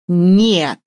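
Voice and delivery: a loud, sharp barked "Нет!". Acted. The director shutting down pushy Vasya, barking. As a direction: bark it to end the talk. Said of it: "рявкнул Максим Владимирович".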